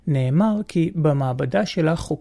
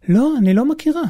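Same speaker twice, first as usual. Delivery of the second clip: high, louder excited reply; 10 semitones higher, 5 dB louder than usual. Retold or imitated. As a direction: gush with excitement. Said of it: "היא אמרה בהתרגשות".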